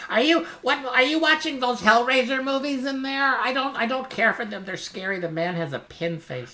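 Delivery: imitating a grandma who hates horror movies; mock grandma. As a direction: squeak it out like a disapproving granny. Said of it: "like someone's grandma would".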